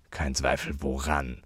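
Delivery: quietly menacing question; threatening. A narrator's reading. Uncle challenging the lady's verdict, quietly threatening. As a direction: keep it down and make it a threat. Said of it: "in recht drohendem Ton".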